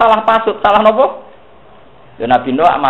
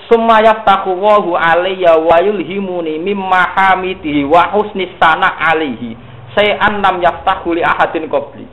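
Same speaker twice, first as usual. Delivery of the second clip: slow Arabic recitation; 4 semitones higher, slower than usual. Acted.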